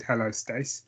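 normal talking voice

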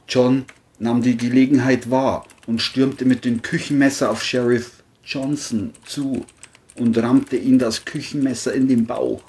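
ordinary delivery, nothing marked

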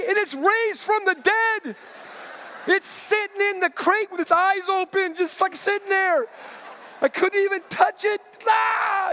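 high-pitched shrieking panic, acted out; hysterical horror. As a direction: wail it as the horrified rabbit owner, building to a scream. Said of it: "this blood-curdling scream".

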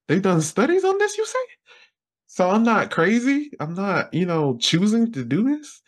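high, excited mock self; high-pitched imitation of young self; giddy relief. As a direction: squeal it like a relieved kid.

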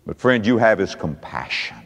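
low, soft, hushed assurance; quiet, heartfelt close; tender. Real, not acted. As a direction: drop your voice and say it gently. Hushed turn to compassion.

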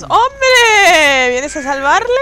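loud, high drawn-out greeting; mock-welcoming surprise. Real, not acted. Killer spotting someone coming to rescue, loud surprised taunt. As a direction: hail the rescuer loudly.

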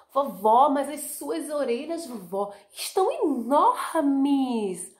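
put-on high, loud then very slow child voice; a small girl stretching out her amazement; high slow wonder; amazed little girl. Girl questioning grandma.

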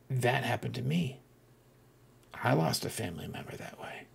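very soft, near-whispered disclosure; hushed confession. Retold or imitated. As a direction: confide a painful loss under your breath. Confiding a shared loss, retold.

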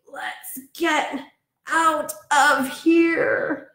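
high, very slow, choked-up voice; gasping burglar. Acted. Burglars fleeing the cloud, choking and gasping the words out slowly. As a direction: drag the words out through the choking.

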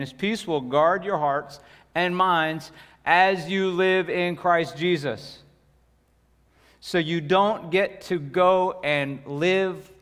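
slow, loud, driving sermon delivery; preaching at full force. Acted. Pastor pressing the congregation, preaching hard. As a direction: lean hard into each phrase.

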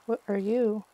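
usual speaking voice

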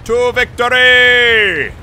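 loud, high, slow rallying cry; rally the boys to victory; battle cry. Acted.

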